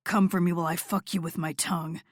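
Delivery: lightly voiced lover, low and fast; a low, close murmur; low male voice. Narrator voices Noah, lower.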